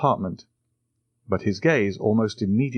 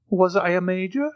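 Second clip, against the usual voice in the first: a touch of timid doubt, higher; 11 semitones higher than usual. A narrator's reading. Old soldier timidly asks the count, mildly timid. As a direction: ask it shyly, unsure of yourself. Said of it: "timidly asked the old soldier".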